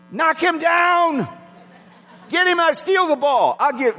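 high, pushed, shouting from the stands; sideline yelling. Retold or imitated. Grandparent yelling at grandchild's game, high excited shout. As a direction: cheer her on like a wild fan. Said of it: "I do, I do it".

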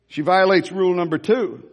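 usual speaking voice

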